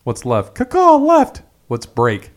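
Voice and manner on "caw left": high squawking parrot call; shrill caw, much higher and louder; chirping bird person. Pretend. Parrot voice-command bit.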